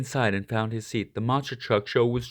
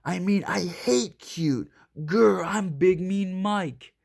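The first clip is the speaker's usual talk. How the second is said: 1 fake tough bluster; 7 semitones higher, slower than usual. A narrator's reading. Mike covering up his softness.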